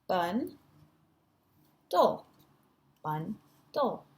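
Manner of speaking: BUN and DULL as isolated chunks with pauses; split into syllables. Shown as a demonstration. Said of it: "these are the syllables".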